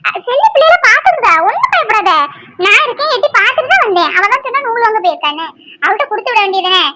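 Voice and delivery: high-pitched, anxious questioning; alarmed concern. Acted. Woman asks crying Aishu what happened, alarmed and reassuring.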